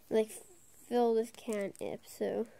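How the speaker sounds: normal talking voice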